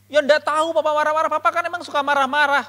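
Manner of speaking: high, loud sibling protest; sulky angry retort. Retold or imitated. Younger sibling answers the elder's question about Papa, indignant.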